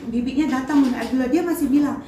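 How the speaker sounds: usual speaking voice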